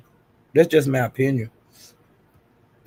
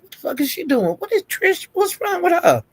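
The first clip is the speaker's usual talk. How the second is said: much higher, stunned and baffled; incredulous disbelief; 13 semitones higher than usual. Retold or imitated. Retelling her reaction to Trish's drama, high incredulous. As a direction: squeal it like you can't believe your eyes. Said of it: "And I'm saying".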